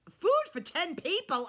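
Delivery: loud, high-pitched disbelief; incredulous outburst. Real, not acted. Vlogger objecting to huge cheat-day meal, incredulous. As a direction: burst out, appalled at the amount.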